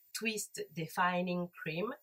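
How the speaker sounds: put-on slow, careful English accent on the product name. Tells her viewers to notice her English accent. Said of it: "Vous avez vu l'accent un peu?".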